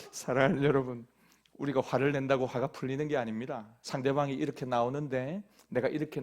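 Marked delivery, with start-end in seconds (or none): none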